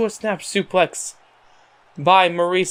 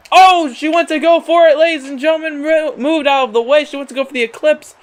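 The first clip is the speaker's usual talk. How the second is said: raised, high-pitched play-by-play burst; excited call; 7 semitones higher, 3 dB louder than usual. Acted. Commentator to viewers as the finisher is attempted, excited. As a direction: jump up in pitch as the big move misses. Live call of a big move.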